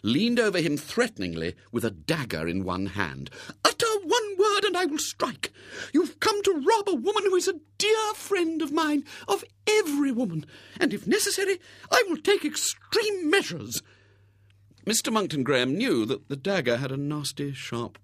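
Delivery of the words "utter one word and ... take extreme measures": threatening spinster. Acted. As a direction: menace him like a dramatic heroine. Miss Rubina threatens with dagger.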